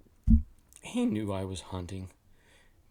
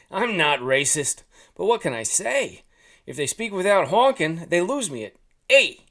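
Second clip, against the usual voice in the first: put-on loud, higher Jersey-accented goose; 6 semitones higher, 8 dB louder than usual. A narrator's reading. Goose defending himself about Canadian friends, Jersey accent. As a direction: argue the point in a raised Jersey accent. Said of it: "in an accent reminiscent of Jersey".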